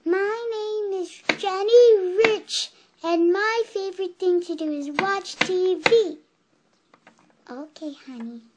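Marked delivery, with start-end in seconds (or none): 0.0-6.2 much louder and higher, on request. Real, not acted. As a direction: project it out to the whole circle. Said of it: "Can you say that a little louder?".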